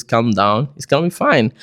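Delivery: ordinary delivery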